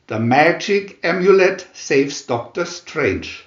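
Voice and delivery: usual speaking voice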